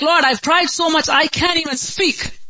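high, strained plea of someone worn out; anguished prayer. Acted. Weary believer tells God they cannot speak, high anguished plea. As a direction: act out a desperate cry, pitched high. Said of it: "when you feel like".

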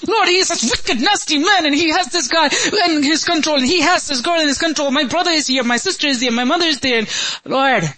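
high, impassioned complaint poured out in prayer; complaining to God. Acted. Believer complains to God about the devil, impassioned pleading. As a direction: plead your case in a high, urgent voice. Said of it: "go complain about the devil to God".

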